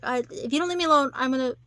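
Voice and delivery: quick, loud mock threat; snapping. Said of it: "then she snaps".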